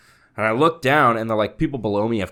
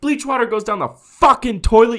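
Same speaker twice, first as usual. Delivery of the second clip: much higher, louder, laughing mock shout; retold yell; 12 semitones higher, 9 dB louder than usual. Narrator yelling at bleach neighbor. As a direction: bark the insult. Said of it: "And I was like".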